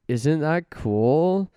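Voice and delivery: normal talking voice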